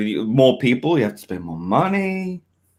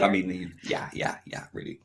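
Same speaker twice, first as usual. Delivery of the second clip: whispering; 7 semitones lower, 12 dB quieter than usual. Real, not acted. Trailing off, embarrassed.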